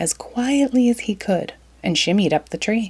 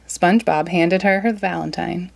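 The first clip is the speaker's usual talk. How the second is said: ordinary delivery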